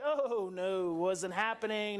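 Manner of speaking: low, drawn-out no-way; firm refusal. Acted. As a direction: draw it out low, ruling it out. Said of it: "you're like".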